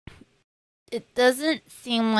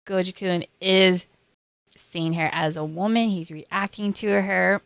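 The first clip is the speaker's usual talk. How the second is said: normal talking voice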